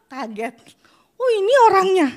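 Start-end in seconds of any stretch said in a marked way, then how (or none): 1.2-2.1 higher, louder startled cry of surprise; shocked employer. Retold or imitated. Interviewer reacting on first seeing her, startled loud cry.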